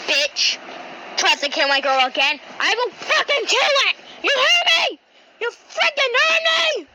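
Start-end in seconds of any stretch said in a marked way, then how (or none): 2.6-3.9 high, furious yell. Acted. Threatening the chainsaw, yelling. 4.2-5.0 angry yell. Acted. Repeated threat. 5.4-6.9 angry yell, stretched. Acted. Repeated threat, stretched.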